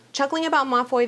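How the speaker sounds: ordinary delivery, nothing marked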